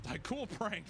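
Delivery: normal talking voice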